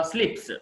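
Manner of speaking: స్లిప్స్ with a crisp "ps"; voiceless ending. Shown as a demonstration. Teacher modelling for learners, స్లిప్స్ with voiceless "ps". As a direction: end స్లిప్స్ on hissed s.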